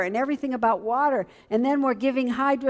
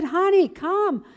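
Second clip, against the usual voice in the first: put-on higher, coaxing old lady voice; an older church lady coaxes the young wife to play, higher and sweetly; 8 semitones higher, 3 dB louder than usual. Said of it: "She said".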